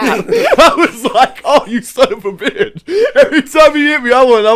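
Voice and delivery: high laughing quote; laughing at getting hit. Real, not acted. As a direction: laugh through the curse. Laughing while quoting getting hit.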